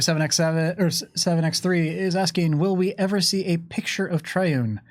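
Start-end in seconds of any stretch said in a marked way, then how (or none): none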